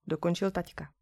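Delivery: usual speaking voice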